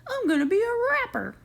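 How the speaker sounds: high, loud sarcastic send-up; mock the dream of being a rapper. Mocking the rapper plan.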